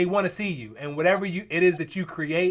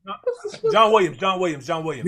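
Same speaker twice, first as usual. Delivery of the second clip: excited; 5 dB louder than usual. Real, not acted. Finally recalls the composer's name.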